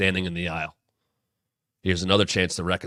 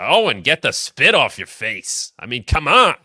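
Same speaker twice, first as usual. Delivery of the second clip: loud, higher Jersey lunch lady scolding; Jersey accent; 4 semitones higher, 7 dB louder than usual. Retold or imitated. Lunch lady yelling at Owen on TV, loud Jersey accent. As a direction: belt it out in a thick New Jersey accent. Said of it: "in her Jersey accent".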